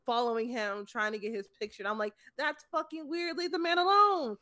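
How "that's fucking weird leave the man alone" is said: high-pitched, exasperated telling-off; exasperated scolding. Retold or imitated. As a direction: tell intrusive fans to back off. Retold scolding of intrusive fans.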